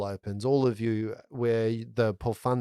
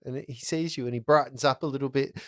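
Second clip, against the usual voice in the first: ordinary delivery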